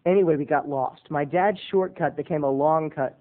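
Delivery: ordinary talk, nothing marked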